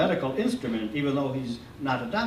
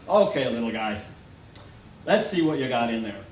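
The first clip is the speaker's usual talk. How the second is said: lower, smooth and soothing doctor; smoother voice; 5 semitones lower than usual. A narrator's reading. Doctor calming the sick boy, smooth and low. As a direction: ease off into a gentle bedside tone. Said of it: "his voice gets smoother".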